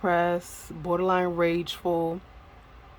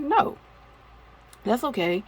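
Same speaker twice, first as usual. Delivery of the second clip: naive refusal; 5 semitones higher, 4 dB louder than usual. Retold or imitated. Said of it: "you know, naively".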